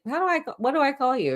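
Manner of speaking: mocking attorney. Vlogger mimics the attorney asking what to call him, squeaky. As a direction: squeak it like a flustered asker.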